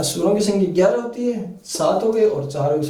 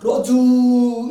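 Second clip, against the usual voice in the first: loud drawn-out call, higher; calling from afar; 5 semitones higher, 4 dB louder, much slower than usual. Shown as a demonstration. Teacher calls to someone far away, long loud call. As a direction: call Raju long and loud with a stretched final ऊ. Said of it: "इस तरह से जोर से आवाज देते हैं ना".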